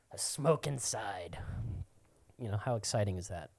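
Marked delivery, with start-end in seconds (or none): 0.1-1.4 slow breathy mumble, imitating; slow mock-despondent whisper; mock weary sigh. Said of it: "they go like".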